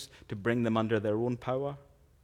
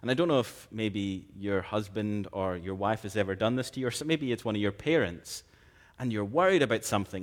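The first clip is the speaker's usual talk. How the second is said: normal talking voice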